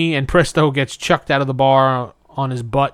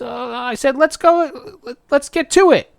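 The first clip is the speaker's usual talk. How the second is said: higher, hasty cowardly climb-down; cowardly backing down; 11 semitones higher than usual. Retold or imitated. Host voicing Presto cowardly backing down to the ogre. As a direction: chicken out in a squeaky rush. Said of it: "Presto cowardly backs down".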